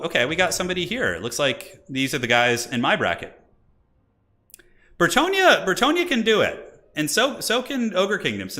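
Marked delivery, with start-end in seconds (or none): 5.0-8.6 rising caster excitement; excited hype. Real, not acted. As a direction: cheer the player's name on. Caster hypes a player.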